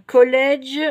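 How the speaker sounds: English words with a French accent; bad English accent. Real, not acted. French vlogger naming a craft collection, poor English accent. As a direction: read the English name in clumsy Frenglish. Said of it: "mon accent anglais tout pourri".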